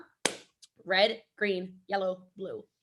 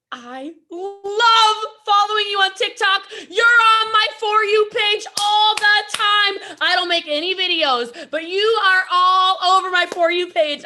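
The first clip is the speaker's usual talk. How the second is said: gushing fan; 9 semitones higher, 8 dB louder than usual. Retold or imitated. Stenographer gushing to Jesse Lee about her TikTok, high sing-song. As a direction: swoon over her like a superfan.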